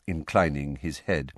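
ordinary talk, nothing marked

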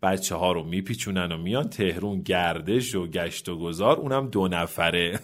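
ordinary delivery, nothing marked

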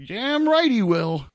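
slow, loud and high gloating; hammed-up victorious retort; smug triumph. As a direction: lean on every word like you won. Gloating at the road manager.

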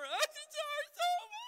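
put-on high, loud, slow child's whimper; high whiny child. Dad imitating his son's high-pitched complaint, slow and loud. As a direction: whimper like a hurt little boy. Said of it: "he's got that high pitch".

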